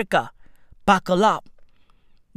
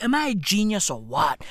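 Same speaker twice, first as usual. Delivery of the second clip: usual speaking voice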